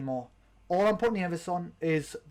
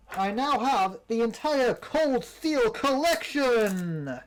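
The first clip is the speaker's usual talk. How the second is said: higher, slow, proud exclamation; excited announcement; 5 semitones higher, much slower than usual. Real, not acted. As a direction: show off the collection with delight. Proudly shows the full collection.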